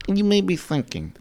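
joking bit in a bad Russian accent. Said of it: "I'm trying to do the Russian accent".

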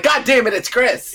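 high, loud exasperated outburst; mock exasperation. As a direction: throw up your hands in mock despair. Exasperated at seeing Chris again.

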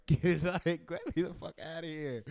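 hint of hammed-up crying; mock crying plea. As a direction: pretend to plead tearfully to be let go. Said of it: "I really should have been crying".

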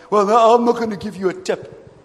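stingy customer. Retold or imitated. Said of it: "customer refusing the tip".

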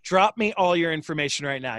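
ordinary delivery

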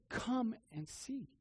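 slow, gentle invitation; "Come" lifted, drawn out softly. Shown as a demonstration. Preacher voicing scripture's invitation slowly and gently. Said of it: "It says".

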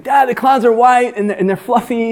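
put-on a small kid telling his dad; child's voice. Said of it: "he's like".

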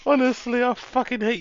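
high, giggly mock complaint; fond insult while laughing; laughing fake outrage. Real, not acted. Laughing at chat's teasing.

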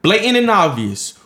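high, loud, worked-up accusation; fired-up scolding of the player; heated rant. Real, not acted. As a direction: call him out, voice rising.

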